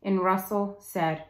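ordinary talk, nothing marked

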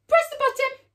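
high, loud, quick burst; playful sudden exclamation; excited command. Pretend. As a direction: urge the children to press. Magic finger pressing the button.

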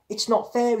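put-on high, loud childish yell. A narrator's reading. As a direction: yell the grievance through the door. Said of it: "he yelled".

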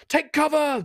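a soldier shouting a warning; alarmed soldier. Acted. Soldier warns comrades in battle, high urgent cry. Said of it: "You hear, like".

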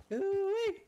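a high, loud whoop; excited holler. Real, not acted. Player calling cheerfully across the game world. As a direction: call out with a gleeful whoop.

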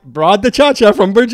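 buddy-buddy, sucking up with a laugh; friendly driver. Retold or imitated. Driver quote continued.

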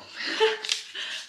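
a high, breathy burst of laughter; surprised laugh. Real, not acted. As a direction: laugh in happy surprise at the gift. Finds money for bike fund.

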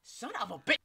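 startled loud outburst; jolted by a jump scare; scared horror game streamer. Real, not acted.

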